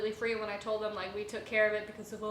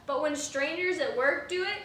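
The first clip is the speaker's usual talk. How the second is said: ordinary talk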